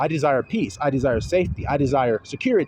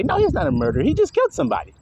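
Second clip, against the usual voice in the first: much higher, sing-song mocking excuse; 11 semitones higher, faster than usual. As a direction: parody a lame excuse, pitched high. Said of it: "Or would you say".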